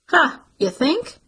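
slow, high, dripping sarcasm; scornful "Huh, you think?"; sarcastic retort. Acted. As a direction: drag it out mockingly.

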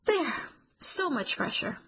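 bright, prim office-lady declaration; declaring. A narrator's reading. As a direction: announce it like a fussy office manager. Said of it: "and declares".

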